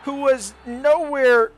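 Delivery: high, loud chance call; excited. Real, not acted. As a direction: burst out at the near goal. Near goal in commentary.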